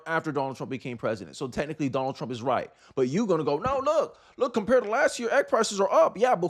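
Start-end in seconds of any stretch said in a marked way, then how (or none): none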